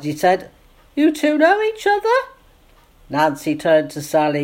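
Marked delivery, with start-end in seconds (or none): 1.0-2.1 feigned surprise, pitched very high; trying to sound surprised. A narrator's reading. Jamie pretends the meeting is news, faked surprise. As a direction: put on an exaggerated show of astonishment. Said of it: "trying to sound surprised".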